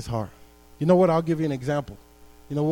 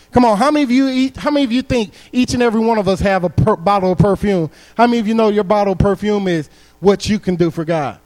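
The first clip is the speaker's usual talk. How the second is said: higher, louder rousing sermon climb; preaching at full force; 6 semitones higher, 7 dB louder than usual. Acted. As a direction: build and lift the congregation. Preacher builds to a peak.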